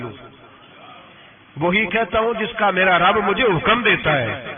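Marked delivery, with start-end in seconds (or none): none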